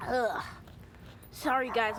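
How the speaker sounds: ordinary talk, nothing marked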